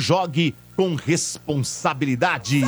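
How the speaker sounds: upbeat sponsor ad voice; ad read. Acted. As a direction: read it like a radio ad.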